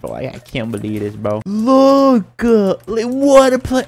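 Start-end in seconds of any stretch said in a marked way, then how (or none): 1.5-3.8 excited. Real, not acted. Showing off his play.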